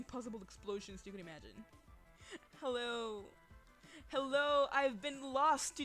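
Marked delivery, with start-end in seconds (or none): none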